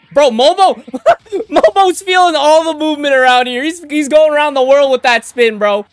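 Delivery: loud, high excited yelling at the screen; hyped shout. Real, not acted. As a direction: cheer the character on like a fan. Hyped reaction to action scene.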